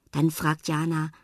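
ordinary delivery

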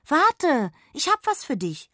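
high, loud call after him. Acted. Jonna calls after the fleeing fairy, high and loud. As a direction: shout to make him wait. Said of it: "rief sie erneut".

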